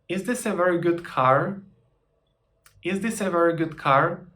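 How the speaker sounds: rising question tune on "car"; question intonation. Shown as a demonstration. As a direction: lift the end of "is this a very good car?" into a question. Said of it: "just to change the intonation".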